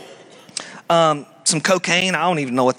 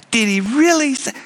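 mocking tempter; 7 semitones higher than usual. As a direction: scoff at God's command. Tempter voice again.

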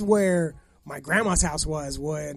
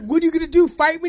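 much higher and louder, daring him; loud taunt; 10 semitones higher, 10 dB louder, faster than usual. Retold or imitated. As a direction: call his bluff, loud. Neighbor squares up to him.

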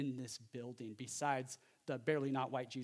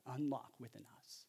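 ordinary talk

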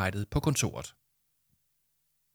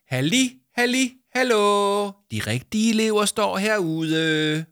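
high, loud drawn-out hallo; shouting; 11 semitones higher, 5 dB louder, slower than usual. Acted. Jama calling to the office people, loud and high. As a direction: wave them over with a call. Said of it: "råbte Jama".

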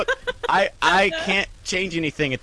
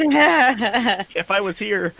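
high slow otter voice; 8 semitones higher, 3 dB louder, much slower than usual. Acted. Otter voice reacts.

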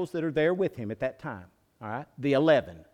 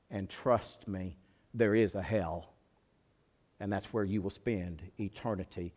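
quiet gravity; 7 semitones lower, 8 dB quieter than usual. Real, not acted. Grave warning after the shout.

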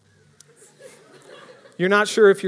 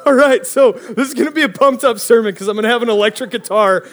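loud, amused through laughter; cracking up while talking; laughing; 3 semitones higher, 5 dB louder than usual. Real, not acted. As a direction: laugh off the interruption. Reacting to music interrupting sermon.

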